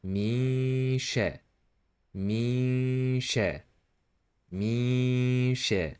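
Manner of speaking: long nasal hum on "Mi" blending into "she"; hidden nun. Shown as a demonstration. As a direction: hide the n and glide into sh. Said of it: "You should hide it and blend it".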